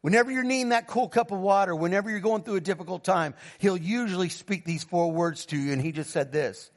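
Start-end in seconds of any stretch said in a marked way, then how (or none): none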